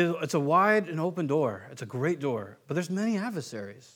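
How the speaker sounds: usual speaking voice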